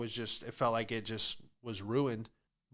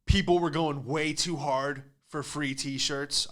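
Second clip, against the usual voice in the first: ordinary delivery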